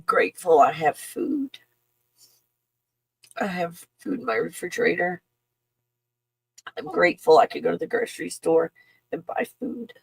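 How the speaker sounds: fighting back tears, voice cracking; choked up. Real, not acted. Grateful viewer moved to tears, voice breaking. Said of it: "I'm not crying".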